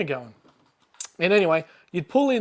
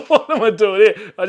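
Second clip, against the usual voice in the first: laughing; 4 semitones higher, 7 dB louder than usual. Retold or imitated. Realising he's armed beside copper.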